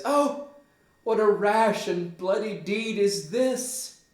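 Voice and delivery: high, loud stage dismay; horrified exclamation. Acted. Gertrude to her murdering son, high loud horror. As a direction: exclaim in shock, pitched high.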